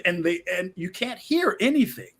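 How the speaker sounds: usual speaking voice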